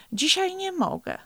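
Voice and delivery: high-pitched, pitiful little excuse; narrated pitiful whine; plaintive. A narrator's reading. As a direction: plead it mournfully, pitch up high. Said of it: "powiedział żałośnie Franklin".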